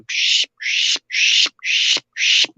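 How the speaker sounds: unvoiced psh bursts; breathy psh calls like bird pishing; imitating a birder pishing to lure birds. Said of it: "So he starts going".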